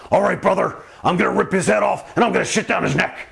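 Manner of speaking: hammed-up pro wrestler threat. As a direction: imitate a wrestler's promo rant. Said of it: "like one of them pro wrestler fellas".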